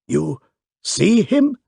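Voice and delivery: a flustered hotel keeper, excited; excited panting. A narrator's reading. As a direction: pitch leaps high on see him, panting and anxious. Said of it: "panted the excited hotel keeper".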